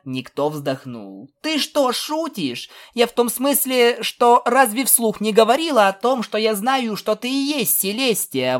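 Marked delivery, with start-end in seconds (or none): 1.4-8.6 high-pitched indignant filly. A narrator's reading. Filly protests she knew his identity, indignant. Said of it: "возмутилась Пегаска".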